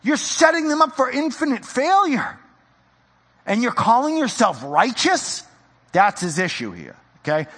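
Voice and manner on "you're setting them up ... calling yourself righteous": much higher, pleading disbelief; horrified Jesus. Retold or imitated. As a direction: cry out in horrified disbelief at them. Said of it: "He's like".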